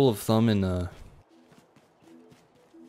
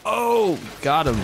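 excited cheer; 7 semitones higher, slower than usual. Real, not acted. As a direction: cheer the hit. Lands a kill.

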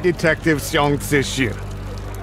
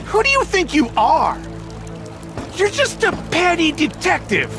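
high mocking sneer; sneering contempt; 11 semitones higher than usual. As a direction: look down on him with scorn. Boss mocks detective.

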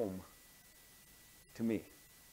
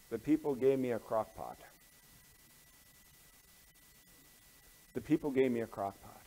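let-down; 4 semitones lower, 4 dB quieter than usual. Acted. Acts disappointed pastor naming his gift.

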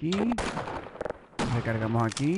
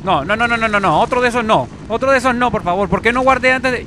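higher, louder frantic no's; panicked shouting; 9 semitones higher, 10 dB louder than usual. Real, not acted. Gamer panicking at a monster before saving. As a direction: beg the monster away in panic. Enemy appears, unsaved progress.

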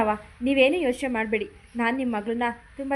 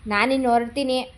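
ನಾನಿನ್ನು said louder, insisting; firmer repeat; 4 dB louder than usual. Acted. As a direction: repeat ನಾನಿನ್ನು ಹೊರಡ್ತೀನಿ louder to make them listen.

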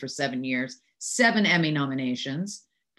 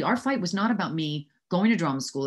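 normal talking voice

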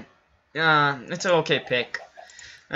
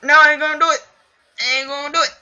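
much higher, louder whine; whiny pretend voice; 13 semitones higher, 6 dB louder, faster than usual. Gamer imagines shooting the sheep, mock whining. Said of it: "be like".

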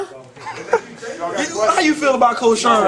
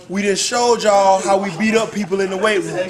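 ordinary delivery

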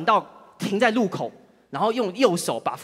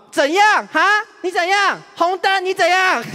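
much higher, louder taunting yell; yelling abuse; 9 semitones higher, 4 dB louder than usual. Retold or imitated. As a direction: scream it at him from the street. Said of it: "继续破口大骂".

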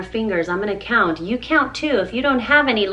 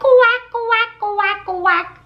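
high, louder duck quack; mama duck quack; 9 semitones higher, 4 dB louder, much slower than usual. Acted. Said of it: "Mother duck said".

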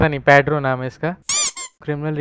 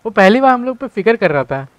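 higher and louder, moved; amazed that he cared at last; excited; 7 semitones higher, 6 dB louder than usual. Real, not acted. Excited about Hank caring.

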